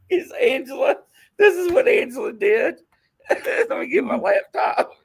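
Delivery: high voice choked with laughter; real laughter squeezing the words high; cracking up. Real, not acted. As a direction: introduce it while losing it laughing. Cracking up introducing Angela's blunder.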